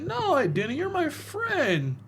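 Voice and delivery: high, slow, drawn-out innocent reply; mock Arthur voice. Friend retells Joker scene, lightly hammed high voice. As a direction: pitch it up and stretch my friend.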